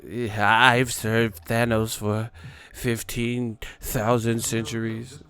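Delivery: lightly voiced slow villain, a touch of menace; Ebony Maw voice. Streamer imitating Ebony Maw for chat, slow mock-villain voice. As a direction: drag the words out like a cosmic henchman.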